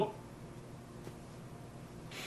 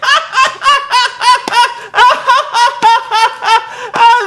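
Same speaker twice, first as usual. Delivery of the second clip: a shrill, forced on-air cackle, much higher and louder; high fake announcer laugh; 8 dB louder than usual. Mocking Cole's forced laugh at the midget. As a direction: ham up a fake commentator laugh. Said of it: "Cole's like".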